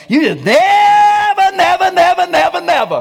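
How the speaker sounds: shrill mock accusation of a partner; mock yelling spouse. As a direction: yell never like a fed-up spouse.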